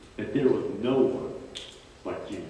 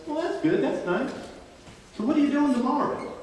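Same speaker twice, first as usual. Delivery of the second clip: buddy voice pitched well up, quicker at the end; a nosy friend fishing for more; prying pal, high and chatty; voicing the buddy; 12 semitones higher, faster than usual. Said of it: "And his buddy says".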